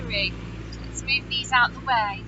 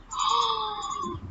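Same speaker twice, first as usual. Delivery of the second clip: put-on sharp gasp for the children; storyteller's appalled intake of breath; shocked gasp; 7 semitones higher, 7 dB quieter than usual. Reacts to unkind remark in story.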